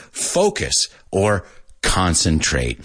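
slow, clear focus or concentrate; careful correct pronunciation. Shown as a demonstration. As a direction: spell it out slowly for learners. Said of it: "Pero dilo bien".

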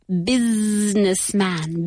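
"Businessman" slowly, with the first s held as a buzzing z; buzzed S. Shown as a demonstration. Teacher showing learners the vibrating s, "Businessman" with a long buzzed z. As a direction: stretch the z in "Businessman". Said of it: "como si fuera una S vibrada".

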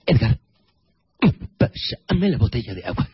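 starts with a grunt, ends whispered. Pretend. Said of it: "empieza en pujido y acaba en susurro".

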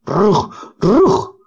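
rising high, crying yelps of "Rug"; frightened dog's bark. Acted. Cornered dog Boris yelps at intruders in the yard, panicked high barks. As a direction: bark in panic as the Rugs come in. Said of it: "gritó".

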